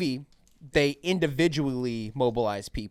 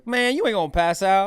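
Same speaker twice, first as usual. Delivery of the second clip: a hint of a louder, higher brushing-off voice; lightly voiced dismissive medic; 7 semitones higher, 8 dB louder than usual. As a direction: wave off the worry. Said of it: "And he goes".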